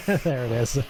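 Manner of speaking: said through a laugh; chuckling as he says it. Real, not acted. Laughing between laughs at scene.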